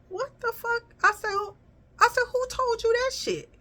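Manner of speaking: squeaky high mock outrage; high-pitched exaggerated "what the"; exaggerated retort. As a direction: play it up high and indignant, as a retelling. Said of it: "You know, I didn't say it like that".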